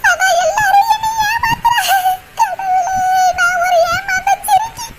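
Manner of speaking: high, slow wailing lament with sobs; high crying wail. Acted. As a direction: wail as if betrayed by everyone. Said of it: "அழுகாதீங்கக்கா".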